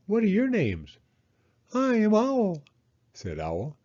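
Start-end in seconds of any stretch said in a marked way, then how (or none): 0.1-1.0 put-on girl voice. Annika child voice. 1.7-2.6 high, quick fussy owl. Acted. Owl character voice.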